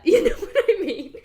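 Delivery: laughs through the words, rising to a loud laugh. Real, not acted. Joking to listeners about making out, laughing. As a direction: giggle it out, then burst into loud laughter. Laughing at her own joke.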